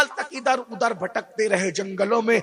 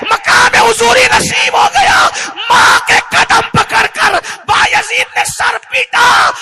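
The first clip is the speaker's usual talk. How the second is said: very loud, high shouted sermon; preacher crying out the mother's prayer and Bayazid's regret; fiery preaching climax; 11 dB louder than usual. Acted. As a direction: shout the lesson to the crowd. Preaching at full force.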